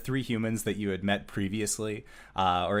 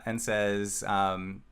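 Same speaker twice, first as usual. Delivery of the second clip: usual speaking voice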